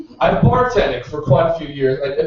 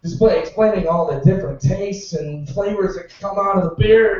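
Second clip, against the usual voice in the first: normal talking voice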